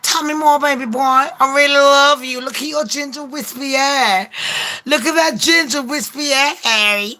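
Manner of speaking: high, cooing mock girlfriend; hammed-up doting flattery to a baby boy; Meghan Markle. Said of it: "And Meghan's like".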